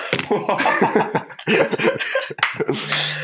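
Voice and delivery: airy high-pitched laugh; giggle, breathy and high. Real, not acted. Laugh after mutiny claim.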